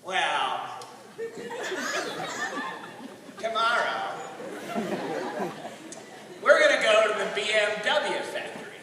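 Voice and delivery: put-on low, halting old Southern drawl; unhurried old Southern man; Jim Spencer, slow drawl. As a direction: answer slowly with long gaps, quietly. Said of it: "And he goes".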